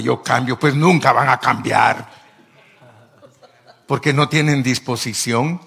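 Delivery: loud, high, driving sermon voice; fiery preaching. Acted. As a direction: thunder at the congregation. Preaching at full force.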